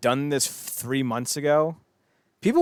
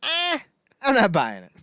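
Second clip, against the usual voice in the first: dismissive imitation; 12 semitones higher, 4 dB louder, slower than usual. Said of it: "That's me".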